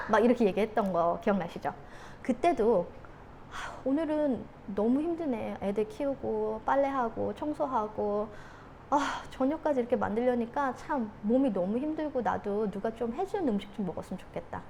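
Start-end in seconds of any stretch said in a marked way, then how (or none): 3.5-14.6 weary. Acted. Tired mom after childcare and cooking, softly weary. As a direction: act worn out after a day's chores.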